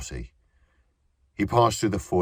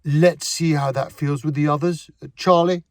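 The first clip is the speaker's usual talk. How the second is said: calling out; 11 semitones higher, 7 dB louder than usual. A narrator's reading. Director calls assistant across stage.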